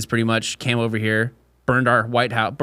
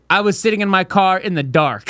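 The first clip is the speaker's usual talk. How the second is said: indignant complaint; 6 semitones higher, 3 dB louder than usual. Retold or imitated. Retold driver complaint.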